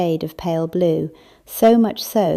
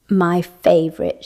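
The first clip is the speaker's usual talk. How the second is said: plain reading voice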